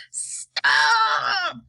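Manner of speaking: high loud scream of stop. Acted. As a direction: scream it to cut him off. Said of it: "screamed ground control".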